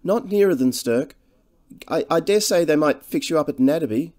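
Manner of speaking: humbly fretful. A narrator's reading. Cottage woman advising a traveller about a bed, humbly fretful. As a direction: speak up humbly in a worried woman's voice. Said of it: "She spoke in a humbly fretful voice".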